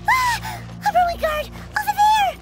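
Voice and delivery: high, startled cry of warning; startled alarm. Acted. Companion spots a Ruin Guard, startled high shout.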